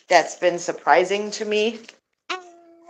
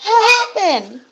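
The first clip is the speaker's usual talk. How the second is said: high pet voice; 11 semitones higher, 5 dB louder than usual. Real, not acted. Asking her pet gander what happened, high baby talk. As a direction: coo up high at the bird like a pet.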